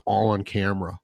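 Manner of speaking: usual speaking voice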